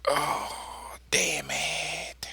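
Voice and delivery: ordinary delivery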